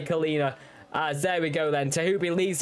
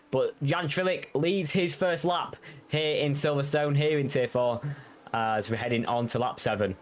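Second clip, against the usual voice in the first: normal talking voice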